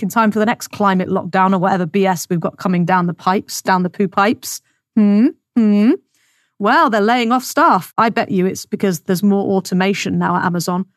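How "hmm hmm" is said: short, rising "hmm?"; questioning hum. Acted. Mock questioning hum after rhetorical rant.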